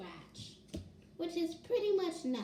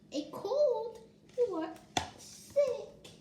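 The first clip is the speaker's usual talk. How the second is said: 1 character voice; 9 semitones higher, slower than usual. Acted. Child voices book character.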